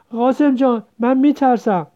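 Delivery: high, loud fearful wail; frightened whine. A narrator's reading. Sister hiding behind brother from dogs, frightened whine. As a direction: whimper to big brother for protection. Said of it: "پشت او پناه گرفت و نالید".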